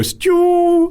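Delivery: high, drawn-out choirboy "Stew"; public schoolboy choir. Retold or imitated. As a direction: sing "Stew" like a posh choirboy. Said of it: "bizarre sort of public schoolboy choir bit".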